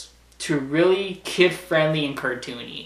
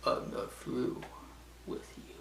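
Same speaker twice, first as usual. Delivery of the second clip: very soft, breathy villain threat; menacing whisper; 17 dB quieter than usual. Retold or imitated. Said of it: "Like that".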